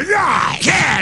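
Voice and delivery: high shouted grunts of a cop clubbing; imitating a cop beating someone; mock violent grunts. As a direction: grunt out each blow. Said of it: "just with death blow power".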